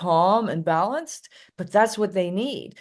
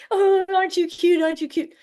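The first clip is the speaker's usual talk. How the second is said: put-on high, louder cooing baby talk; 11 semitones higher, 4 dB louder than usual. The owner cooing at the tiny dog he picked up, high baby talk. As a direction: coo at the little dog like a baby.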